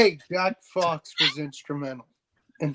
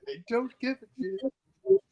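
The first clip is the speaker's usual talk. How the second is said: usual speaking voice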